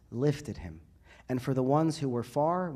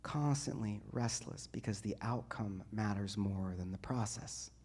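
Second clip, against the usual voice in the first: quiet; 3 semitones lower, 9 dB quieter than usual. Acted. Drops after the outburst.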